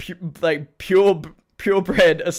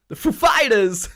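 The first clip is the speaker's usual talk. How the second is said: high, loud, drawn-out impression; 8 semitones higher, 7 dB louder, slower than usual. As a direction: announce it big, stretching the second word. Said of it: "[name] impersonating [name] to say".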